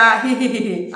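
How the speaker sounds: feeble acted tittering; small giggle. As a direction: give a little hee-hee giggle instead of a real laugh. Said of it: "చిరునవ్వు కాదు boss". (చిన్నగా ఇకిలిస్తూ)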